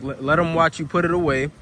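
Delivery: normal talking voice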